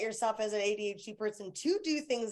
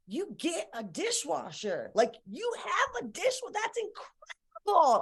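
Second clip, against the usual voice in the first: mock wonder; 5 semitones higher, slower than usual. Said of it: "I was like".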